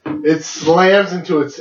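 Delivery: usual speaking voice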